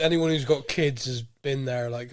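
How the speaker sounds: ordinary delivery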